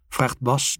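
ordinary delivery, nothing marked

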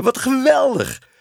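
high, loud cry of delight; soaring, thrilled outburst; overjoyed relief. A narrator's reading. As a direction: exclaim it in sheer elation.

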